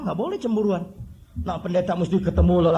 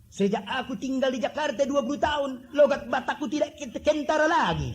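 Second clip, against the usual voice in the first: put-on Batak accent, higher, with a laugh breaking through; 7 semitones higher than usual. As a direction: imitate a Batak man in his thick Batak accent, chuckling. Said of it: "Saya ingat ada satu orang Batak".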